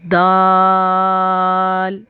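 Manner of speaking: दाल drawn out very slowly, each sound held; letter demo. Shown as a demonstration. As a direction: stretch दाल out like a recitation drill. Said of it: "इसके बाद आता है".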